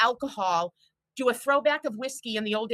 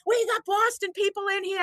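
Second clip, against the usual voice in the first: pitched way up, cheering about the Boston crowd; excited call; 10 semitones higher than usual. Retold or imitated. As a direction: hype it like a bar host on the mic. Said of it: "And they're like".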